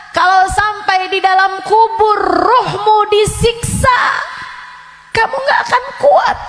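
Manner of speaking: high, loud warning about the grave; fiery preaching. Acted. The ustazah warns her listeners, loud and fiery. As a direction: preach it out, rising to a shout.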